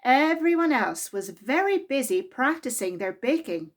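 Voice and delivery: usual speaking voice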